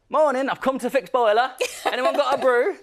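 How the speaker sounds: hammed-up Northern workman's accent in a disguise; Northern janitor accent. Disguised as a janitor greeting a school, hammy Northern English accent. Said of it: "I'm not very good at accents".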